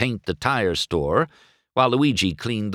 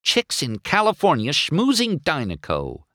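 sour, jealous gripe; resentful grumbling; griping; 7 semitones higher than usual. Acted. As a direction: grumble about the rival. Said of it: "he griped".

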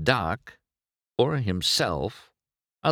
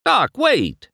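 calling out; 6 dB louder than usual. Acted. McQueen calls after leaving Doc.

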